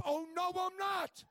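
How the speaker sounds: loud, slow, high shout; mock insistence. Preacher insisting to the crowd, loud slow shout. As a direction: insist it like a challenge.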